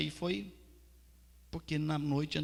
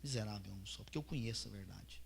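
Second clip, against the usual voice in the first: quiet confession; 6 semitones lower, 11 dB quieter than usual. Retold or imitated.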